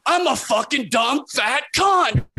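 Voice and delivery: slow, high, shouted taunt; mocking shout. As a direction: stomp and yell it like a ranting comic. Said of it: "he starts dancing around the stage".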